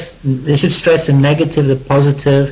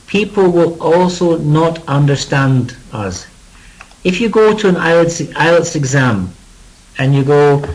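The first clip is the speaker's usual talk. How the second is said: normal talking voice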